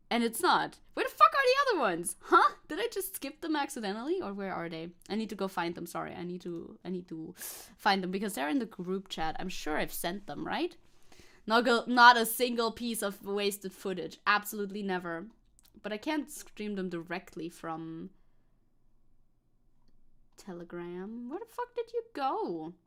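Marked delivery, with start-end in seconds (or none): 0.9-4.2 high-pitched exasperated demand; exasperated bafflement. Real, not acted. Cannot find the other videos. 21.3-22.7 exasperated bafflement. Real, not acted. Searching for missing videos.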